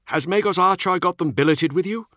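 Nemiel. A narrator's reading. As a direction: lift the voice for the younger marine. Nemiel voiced in the story.

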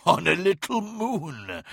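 high, slow, gleeful creature voice; giggling monster. A narrator's reading. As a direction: draw it out with wicked, giggly delight. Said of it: "giggled the monster".

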